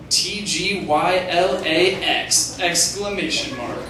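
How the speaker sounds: each letter spelled out slowly and deliberately; slow spelling out. Acted. Replying to dad with nonsense letters, slowly spelled. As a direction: spell it out slow, like a smug code.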